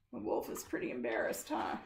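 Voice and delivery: soft whispered aside. A narrator's reading. Telling a child the wolf is embarrassed, whispered. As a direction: whisper it like a secret.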